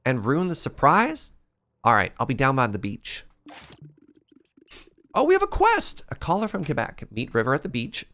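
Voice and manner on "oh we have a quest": excited. Real, not acted. Gamer excited at quest.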